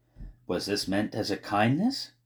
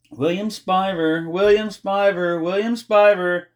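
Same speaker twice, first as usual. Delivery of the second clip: hopeful repeated calling; 10 semitones higher, 6 dB louder than usual. A narrator's reading. Girl desperately repeating his name, hopeful calling. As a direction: keep calling the name, higher and louder each time. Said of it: "hopefully kept saying his name".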